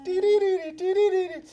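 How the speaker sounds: high-pitched "dee-dee-dee" theme; quick, high mimicked theme-tune notes; imitated eerie jingle. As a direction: sing out a spooky little sci-fi motif. Imitates eerie theme after reading shirt.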